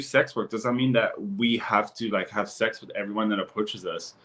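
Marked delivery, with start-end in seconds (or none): none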